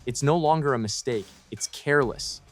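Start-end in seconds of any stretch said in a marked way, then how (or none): none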